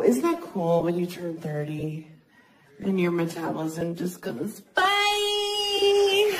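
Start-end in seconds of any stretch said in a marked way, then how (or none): 4.7-6.3 high shouted sing-song bye; cheery goodbye. Pretend. Metabolism ditching her at thirty, high shouted bye. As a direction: wave it off like a carefree friend.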